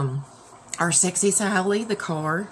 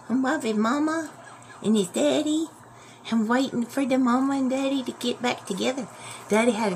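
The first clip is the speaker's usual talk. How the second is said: voicing the dog; 7 semitones higher than usual. Owner speaking for her dog Jack to the viewers, high cutesy puppy voice. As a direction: speak for the dog as a sweet little pup.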